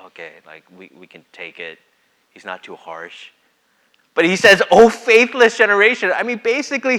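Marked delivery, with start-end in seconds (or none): 0.1-3.3 gentle. Retold or imitated. Voicing a gentle Jesus. 4.7-6.1 exasperated. Retold or imitated. Quoting Jesus's exasperation.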